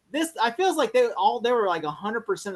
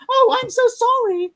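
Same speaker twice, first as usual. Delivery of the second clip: much higher, slow, breathy-sweet apology; mock sweet girl; 7 dB louder than usual. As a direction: squeak it out like a coy heroine. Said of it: "she goes".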